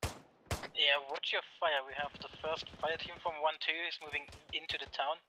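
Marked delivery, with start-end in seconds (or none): none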